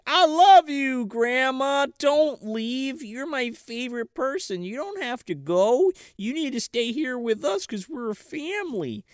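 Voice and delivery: high, drawn-out mock-tearful plea; cracking emotionally. Grandson cracks and runs to his grandma, high mock-weepy pleading. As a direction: wail tenderly, pitched well up, softening as it goes. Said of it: "he finally cracks".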